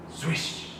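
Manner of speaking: ordinary delivery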